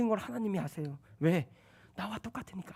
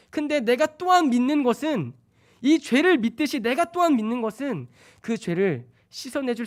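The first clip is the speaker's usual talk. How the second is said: loud, high, fervent preaching; preaching at full force; 7 semitones higher, 7 dB louder than usual. Acted. Preacher stirring the congregation, loud and fervent. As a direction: drive the confession up to the rafters.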